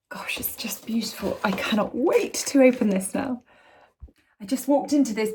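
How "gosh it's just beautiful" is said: awed whisper. Real, not acted. Reading the card, seeing necklace.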